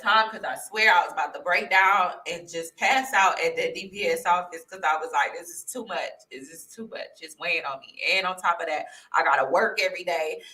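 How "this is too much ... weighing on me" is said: soft, low, worn-down murmur; overwhelmed. Retold or imitated. Recalling her breakdown at the DPS, quiet and overwhelmed. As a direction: retell feeling swamped, voice sinking.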